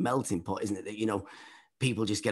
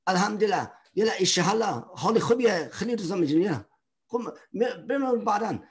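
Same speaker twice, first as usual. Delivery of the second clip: higher, chatty faux-Arabic gibberish; mock Arabic babble; 7 semitones higher than usual. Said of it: "be going".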